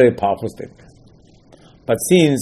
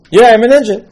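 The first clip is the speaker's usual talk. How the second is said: proud claim; 10 semitones higher, 8 dB louder than usual. Retold or imitated. Mocking a self-proclaimed angel.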